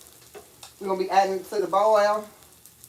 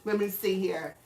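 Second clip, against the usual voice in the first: usual speaking voice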